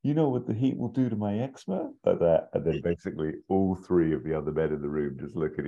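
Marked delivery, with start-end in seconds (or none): 0.0-1.9 high, whiny complaint; fussy guard whining to his boss about the heat. Retold or imitated. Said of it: "Candy gives it a".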